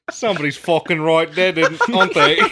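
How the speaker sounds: loud, brisk mock Cockney accent; hammed-up Cockney sleuth accent. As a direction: push the Cockney accent hard. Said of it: "turning Cockney".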